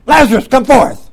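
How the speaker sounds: booming call into the tomb; yelled command. Retold or imitated. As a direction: blast it out to wake the dead. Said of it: "and he yelled".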